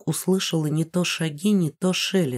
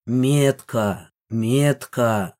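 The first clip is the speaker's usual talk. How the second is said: low, slow beastly growl; monster growl; 6 semitones lower, much slower than usual. Acted. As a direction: growl the word like a creature. Monster snarls at the heroine.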